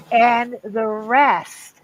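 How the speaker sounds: drawn-out hinting and the rest; slow pointed prompt. Retold or imitated. Brother slowly coaxing dad to turn around, drawn out. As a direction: spell it out slowly to the confused dad.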